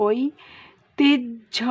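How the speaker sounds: ঐতিহ্য spoken very slowly as "oi-ti-jjho"; slow demo. Shown as a demonstration. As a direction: draw out ঐতিহ্য, ending in a clear "jjho". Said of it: "ওইটাকে ভাঙলে হয়".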